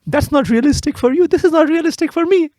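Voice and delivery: high-pitched, insistent objection; mock protest. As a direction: argue it back with comic exasperation. Mock dispute over film realism.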